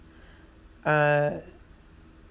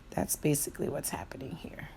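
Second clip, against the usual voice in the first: soft whispered remark; whispered aside; 12 dB quieter, faster than usual. Real, not acted. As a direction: whisper it to the camera. Aside while showing eyelids.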